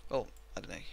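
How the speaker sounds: ordinary talk, nothing marked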